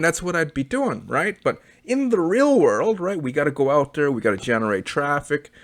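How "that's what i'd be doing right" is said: usual speaking voice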